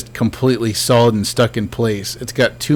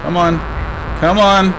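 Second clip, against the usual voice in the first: impatient shout; 8 semitones higher than usual. Real, not acted. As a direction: yell at the ghost to hurry. Yelling at ghost to act.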